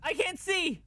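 very high, alarmed cry; panicked cry. Real, not acted. Panics, unable to see.

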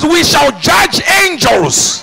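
slow, loud proclamation; preacher declaring scripture loudly; proclaimed. Acted. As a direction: boom it out slowly.

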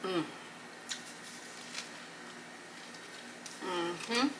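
humming while chewing; mid-bite sounds; mouth full of sandwich. Real, not acted. Seen 1: Biting and chewing a sub sandwich, not pickle; pickle eaten earlier.

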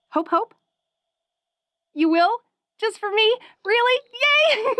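rising, giddy delight; bubbly. Acted. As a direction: squeal with happy disbelief. Listener agrees to try mask.

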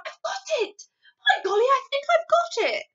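very high, loud eureka; excited cry. A narrator's reading. Sophie struck by a plan, thrilled outcry. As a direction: burst out with sudden delight. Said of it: "in a voice filled with excitement".

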